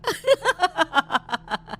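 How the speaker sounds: high witch cackle; evil cackle. Acted. Witch laughs after threat. (चुड़ैल की डरावनी हंसी)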